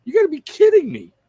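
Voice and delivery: loud, high disbelieving huh; incredulous reaction. Retold or imitated. Streamer imitating his reaction to army memes, loud disbelief. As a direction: act stunned at a ridiculous post. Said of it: "looked at and went".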